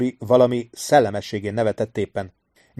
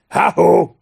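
high, loud call across the water; loud hail; 8 semitones higher, 7 dB louder than usual. A narrator's reading. Amos hailing the brothers from his ship, loud high call. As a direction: hail them from the ship's deck. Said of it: "kiáltotta".

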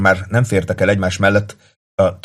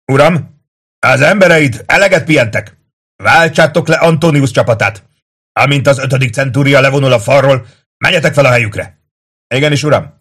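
high, loud military exchange; shouted orders; 6 semitones higher, 6 dB louder than usual. A narrator's reading. Tullius and Kato across the palisade, shouting. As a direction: project commands from the wall.

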